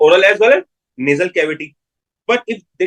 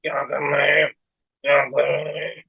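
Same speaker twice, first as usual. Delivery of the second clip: softer, nasal twang, as if through the nose; nasal voice demo; 4 semitones lower, 6 dB quieter, much faster than usual. Shown as a demonstration. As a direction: show how a hypernasal patient sounds. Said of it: "it will sound like कि आवाज नाक से आ रही".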